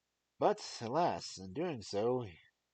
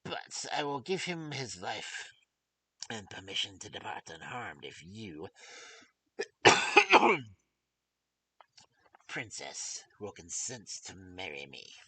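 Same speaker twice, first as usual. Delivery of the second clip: dwarf villain; 3 semitones higher, 8 dB louder than usual. Acted. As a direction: threaten like the wicked little dwarf. Dwarf's threat in story.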